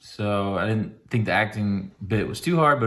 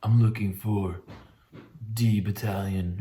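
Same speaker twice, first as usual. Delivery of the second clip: dead-faced exhausted; 2 semitones lower, 4 dB quieter than usual. Retold or imitated. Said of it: "just dead face".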